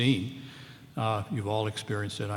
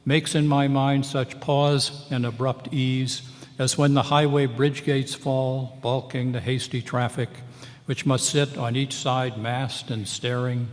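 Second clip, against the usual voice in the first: poem reading; 2 semitones higher, 4 dB louder than usual. Acted. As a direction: read the poem aloud, lingering on the stresses.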